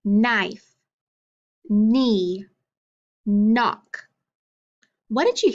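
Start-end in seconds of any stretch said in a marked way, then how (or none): 0.0-4.1 long held N opening "knife", "knee" and "knock"; "knife", "knee", "knock" each begun on a drawn-out hummed N; stressed initial sound. Shown as a demonstration. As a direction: stretch the first N of "knife", "knee", "knock", each word set apart. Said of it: "try to echo 'em back with me".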